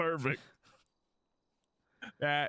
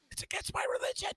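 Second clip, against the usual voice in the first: unvoiced whisper; mock-solemn whisper. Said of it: "with that voice".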